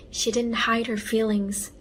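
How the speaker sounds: plain reading voice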